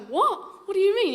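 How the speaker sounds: high, startled what-do-you-mean; shocked disbelief. Retold or imitated. Missionary baffled by the woman's remark, mock shocked. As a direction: caught off guard, pitch jumping up.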